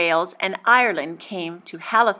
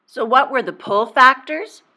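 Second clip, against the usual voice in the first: ordinary talk, nothing marked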